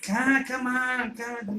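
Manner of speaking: put-on slow, high, soft coaxing old man; lightly voiced grandpa luring a kid gently; drawn-out gentle come-here call.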